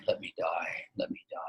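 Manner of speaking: fading to a breathless whisper; begging in a faint whisper. Retold or imitated. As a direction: let the plea die away to a whisper. Dying man begging to die.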